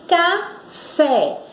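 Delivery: "café" split in two, weight on fé; stressed syllable demo. Shown as a demonstration. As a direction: say "café" as ca, pause, FÉ. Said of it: "é pronunciada com mais força".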